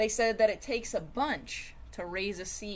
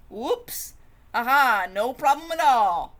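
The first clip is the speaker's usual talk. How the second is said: loud, high, forced cheerfulness; forced cheer; 4 semitones higher, 10 dB louder than usual. Acted. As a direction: laugh off the mishap, too brightly. Sweet grape brushes off mishap.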